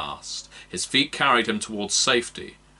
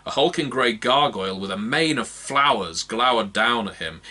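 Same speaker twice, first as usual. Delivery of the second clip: usual speaking voice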